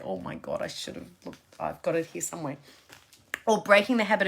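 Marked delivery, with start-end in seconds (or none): 0.0-2.6 whispered, much softer aside; real embarrassed whisper; muttered aside. Real, not acted. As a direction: drop to a whisper to yourself. Aside after fumbling a name.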